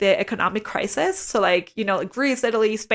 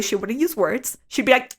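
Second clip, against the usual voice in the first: usual speaking voice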